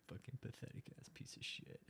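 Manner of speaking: breathy whisper, fully unvoiced; whispered insult. Real, not acted. Scorning a YouTuber's reruns, whispered. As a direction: hiss it under your breath in disgust.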